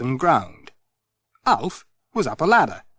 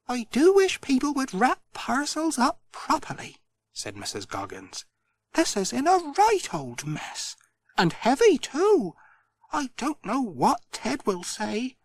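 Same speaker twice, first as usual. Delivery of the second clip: higher-pitched fussy shopkeeper voice, grumbling; fussy Missus Goggins; 12 semitones higher, 3 dB quieter than usual. Acted. Missus Goggins grumbles to Pat about the parcel, higher fussy voice. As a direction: tut over the badly wrapped parcel.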